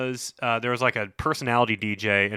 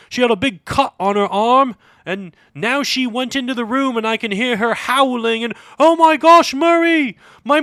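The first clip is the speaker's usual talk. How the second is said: much higher and louder British caller; 13 semitones higher, 10 dB louder than usual. Retold or imitated. Prank caller to the radio show in a high, loud British voice. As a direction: panicky high voice of a British kid. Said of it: "a guy would call and be like".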